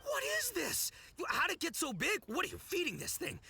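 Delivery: amazed. Acted. Amazed by huge beetle.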